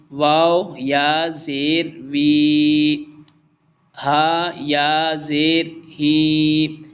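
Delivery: "वाव या ज़ेर वी" drawn out, each syllable long; slow drill recitation. Shown as a demonstration. Tutor demonstrating the last line to the student, slow recital. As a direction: recite each letter slowly. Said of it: "last one पढ़ते हैं".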